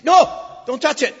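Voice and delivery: a sudden loud, high warning shout; sharp shout. Shown as a demonstration. Parent shouting at a child reaching for the stove, loud and sharp. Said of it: "so you do this with emphasis".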